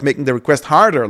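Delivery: usual speaking voice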